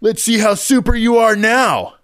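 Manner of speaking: high, slow, taunting snarl; snarling teen. Acted. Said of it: "Blondie snarls".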